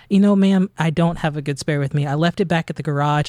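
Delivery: ordinary talk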